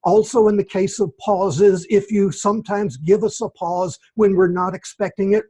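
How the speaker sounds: ordinary talk, nothing marked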